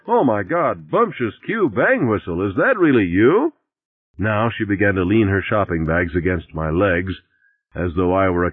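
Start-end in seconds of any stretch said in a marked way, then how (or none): 0.1-3.5 parodied piercing cry of recognition, rising at the end; imagined bag-lady cry. A narrator's reading. Narrator imagines her parroting any name, mock outcry. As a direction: mimic her high, astonished outcry. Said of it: "I thought she would have cried".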